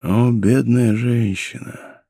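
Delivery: slow, drawn-out lament; hopeless pity, not fully whispered; despairing murmur. A narrator's reading. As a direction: grieve slowly, in understated despair. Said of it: "в отчаянии шептал Атос".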